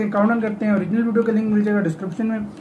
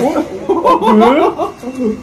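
high, loud laugh; burst of laughter; 5 semitones higher, 5 dB louder than usual. Real, not acted. Reacting with a co-host to the robot dog, laughing out loud. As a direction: crack up loudly.

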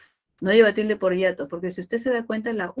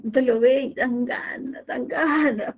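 mock craving; 4 semitones higher than usual. Teacher acts out temptation to students.

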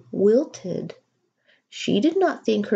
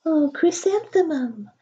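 dreamy flower voices; 10 semitones higher than usual. A narrator's reading. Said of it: "the flowers seemed to say".

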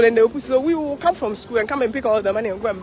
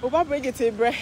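ordinary delivery

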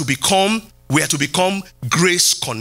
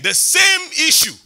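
high, loud, slow cry of the same issue; preaching shout; 11 semitones higher, 3 dB louder than usual. Real, not acted. Preacher crying out to his church, loud and high. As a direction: ring it out from the pulpit. Preacher driving home a point.

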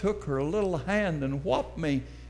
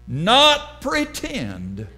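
ordinary talk, nothing marked

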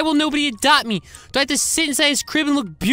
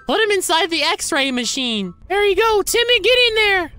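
ordinary talk

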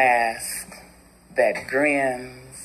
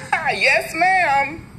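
much higher, eager deferential reply; servile yes ma'am; 12 semitones higher than usual. Acted. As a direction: sweeten it for the child she works for. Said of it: "I say".

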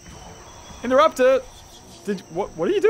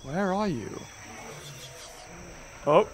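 usual speaking voice

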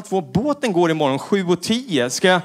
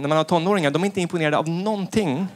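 ordinary delivery

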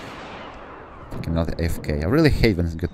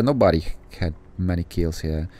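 usual speaking voice

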